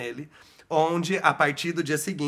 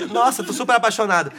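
"apaixonado" gushed out, higher and louder, hammed-up swoon; mock lovestruck gush; 5 semitones higher, 5 dB louder than usual. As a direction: play a hammed-up besotted lover. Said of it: "Aí eu fui".

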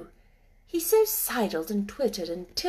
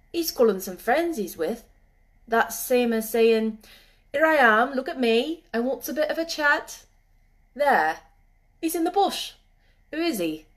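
put-on louder, lively country-boy dialect; rustic boy, pitch jumping about; moor boy; 3 semitones higher, 5 dB louder than usual. A narrator's reading. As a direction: translate the bird's call like a keen moor lad.

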